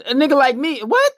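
high, loud outraged what; indignant outburst. Retold or imitated. Man scoffing at a girl who refused a compliment, high indignant outburst. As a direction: bristle at her, pitch jumping up on what.

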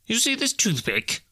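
high-pitched character voice; Scrooge. A narrator's reading. Scrooge distracting the ghost's stony gaze, high-pitched challenge. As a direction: test the phantom in a high voice.

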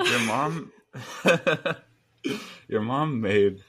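giggling through the words; laughing. Real, not acted. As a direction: laugh while you say your mom. Laughing while telling the story.

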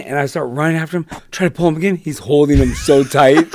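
ordinary delivery

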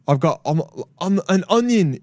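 lightly voiced ogre, off the mark; Shrek. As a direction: attempt a cartoon ogre, not quite landing it. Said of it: "that's not what he sounded like at all".

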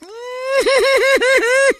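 imitated high-pitched wail; high wailing scream. Co-host recreating Megan's cry after the car door shut, high wail. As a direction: mimic a sudden shrill cry of pain.

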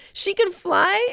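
high-pitched mock astonishment; imitated amazement. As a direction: squeak it as an amazed onlooker. Voices Chip's amazed reaction.

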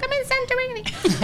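very high Liverpudlian singsong; Liverpudlian mother. Retold or imitated. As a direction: squeal it in a Liverpudlian accent. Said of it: "all of her Liverpudlian accent".

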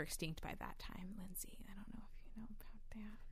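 teasing hushed whisper. Pretend. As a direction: whisper it like a snarky secret. Teasing whisper correcting friend.

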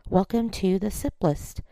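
usual speaking voice

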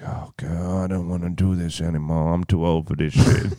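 low, quiet world-weary groan of a reply; weary reluctance. Retold or imitated. Telling the friend how the heroine balks at the mission, weary and reluctant. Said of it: "And she's like".

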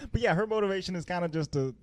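ordinary talk

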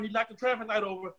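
ordinary talk, nothing marked

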